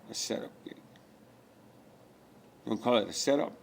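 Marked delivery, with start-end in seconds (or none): 0.1-0.8 soft whispered repeat, almost to himself; whispered echo. Acted. Repeating his own phrase to himself, whispered. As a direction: breathe the phrase back in a whisper.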